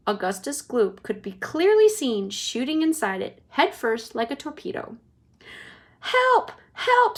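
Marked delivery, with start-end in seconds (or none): 6.0-7.2 very high panicked scream; mother screaming very high. Acted. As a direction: scream in terror. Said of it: "screamed Missus Gloop".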